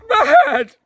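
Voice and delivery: very high, loud shrieking; frantic scream. Acted. Minstrel screaming at the barbarians, shrieking. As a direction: scream it in despair.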